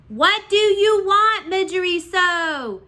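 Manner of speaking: high, slow, loud princess call; drawn-out shout from the princess. A narrator's reading. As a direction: shout it slowly across the dungeon. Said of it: "the princess shouted".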